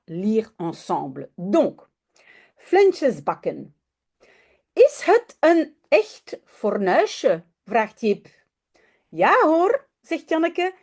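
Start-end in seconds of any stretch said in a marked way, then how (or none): 4.7-7.4 lightly voiced curious little boy; a hint of a small boy asking; child's question. A narrator's reading. Teacher reading Jip's question to her class, lightly voiced boy. 9.1-9.9 lightly voiced eager little girl, higher; a hint of a cheerful girl; girl's reply. A narrator's reading. Teacher voicing Janneke answering Jip, light girl voice.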